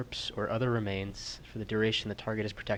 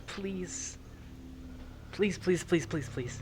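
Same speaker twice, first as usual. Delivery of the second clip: begging; 9 semitones higher, slower than usual. Real, not acted. Player begging the dice.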